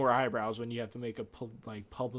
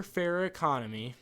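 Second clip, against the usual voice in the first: normal talking voice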